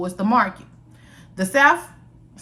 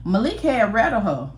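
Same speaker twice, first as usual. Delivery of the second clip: plain reading voice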